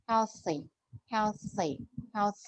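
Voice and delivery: "Healthy" said softly, th breathed between the teeth; tongue-between-teeth th. Shown as a demonstration. Teacher drilling the th for Bích, soft careful th. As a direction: softly push air out on the th of "healthy". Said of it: "Đặt lưỡi giữa hai hàm răng".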